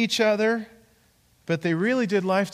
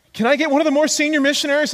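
high, louder, rushed nervous request; scared, mock panic; 10 semitones higher, 5 dB louder, much faster than usual. Scared young missionary asking for help, mock panic. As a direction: imitate someone panicking and asking for backup. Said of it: "he was really scared".

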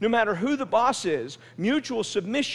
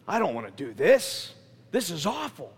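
low, grudging grumble; bad attitude; 7 semitones lower than usual. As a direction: drag it out like a sulky complaint. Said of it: "you have this bad attitude".

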